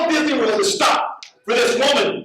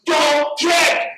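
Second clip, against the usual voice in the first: imitating yelling crowd; 8 semitones higher, much slower than usual. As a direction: call out urgently like a worried crowd. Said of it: "all people with her going".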